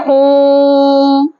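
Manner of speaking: ओम sustained on a level pitch; prolonged Om. Shown as a demonstration. Teacher demonstrates how Om is chanted, prolonged Om. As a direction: hold ओम very long, unwavering. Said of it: "ऐसे उच्चारण करते हैं".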